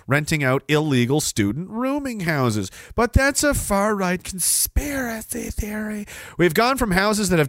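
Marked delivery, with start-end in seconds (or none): 2.9-6.1 high, slow mock voice; mocking dismissal. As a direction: draw it out high in sarcastic mimicry. Mocking those who dismiss it.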